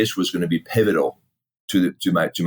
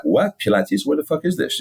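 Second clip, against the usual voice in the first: mock disbelief, pitch jumping up; baffled retold reaction; 9 semitones higher than usual. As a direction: act out being thrown by an unknown word. Retells baffled reaction to Pilates.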